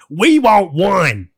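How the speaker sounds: slow, heavy, deliberate demand. Retold or imitated. The US barging into the meeting, slow demand. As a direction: stretch each word out like a bully at the table. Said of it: "They came in".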